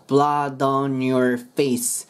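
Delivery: slow "Blood on your face"; linked D. Shown as a demonstration. Teacher demonstrating consonant linking, slow and joined. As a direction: carry the D of "Blood" into "on". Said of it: "次が母音の時は乗っかかる".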